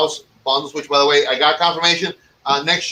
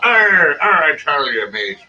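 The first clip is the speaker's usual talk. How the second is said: put-on lightly growled Irish pirate accent; a hint of Irish pirate brogue; 3 dB louder than usual. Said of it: "it sounded like an Irish pirate".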